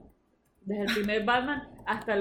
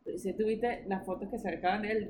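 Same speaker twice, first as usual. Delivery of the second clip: ordinary talk, nothing marked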